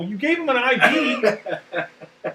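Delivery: high retold question breaking into laughter; incredulous. Sergeant reacting to Doc's confession about the IV, incredulous. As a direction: repeat it back in high mock disbelief.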